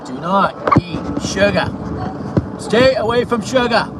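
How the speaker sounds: ordinary delivery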